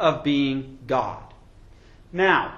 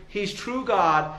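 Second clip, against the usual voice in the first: ordinary delivery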